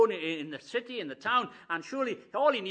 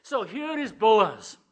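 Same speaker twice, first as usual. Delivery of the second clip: usual speaking voice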